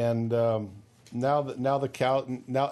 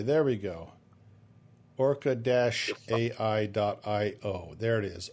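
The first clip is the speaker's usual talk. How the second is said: ordinary talk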